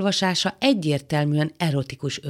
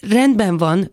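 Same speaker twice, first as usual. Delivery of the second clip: louder, higher, hurried retreat; hasty backing down; 5 semitones higher, 7 dB louder, slower than usual. Acted. Man rebuffed by the woman, hastily retreating. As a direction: back off at once, voice raised. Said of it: "felelte Lavran gyorsan visszakozva".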